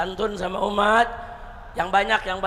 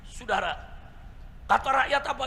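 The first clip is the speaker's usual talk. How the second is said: usual speaking voice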